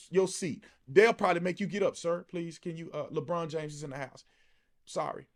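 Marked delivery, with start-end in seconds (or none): 2.0-5.3 soft, low mock-polite request; apologetic restaurant staff. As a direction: play staff quietly asking a diner to move.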